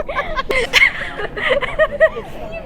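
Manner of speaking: embarrassed high laugh; high giggling laugh. Real, not acted. Laughing at herself on camera.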